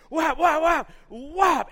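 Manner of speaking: loud, high whaps; shouted punch noises. Retold or imitated. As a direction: shout each blow landing. Imitates fighter's punches.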